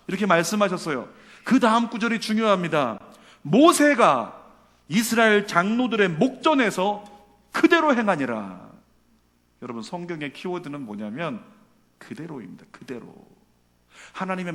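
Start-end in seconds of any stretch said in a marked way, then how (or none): 3.4-8.7 loud, high, weighty verse reading; emphatic scripture proclamation. A narrator's reading. Preacher stressing the important next verse, emphatic proclamation. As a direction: ring out the key verse, slowing at the end.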